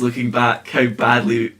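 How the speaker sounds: ordinary talk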